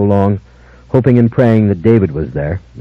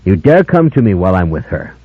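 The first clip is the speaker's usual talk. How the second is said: usual speaking voice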